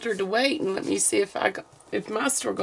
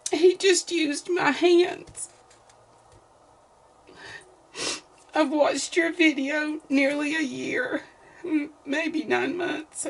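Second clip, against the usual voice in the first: happy crying; 6 semitones higher, slower than usual. Real, not acted. Reading a kind letter, tearing up.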